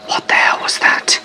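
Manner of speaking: frightened unvoiced whisper. Campers whispering to each other in the dark tent, hushed. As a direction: whisper it so nothing hears you. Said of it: "We whispered to each other".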